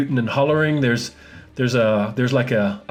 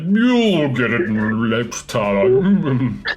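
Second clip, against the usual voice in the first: very slow, smeared creature voice; drawn-out, blobby plasmoid voice; plasmoid calling out; 4 dB louder, much slower than usual. Acted. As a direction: slur the words out like a blob. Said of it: "the plasmoid calls out".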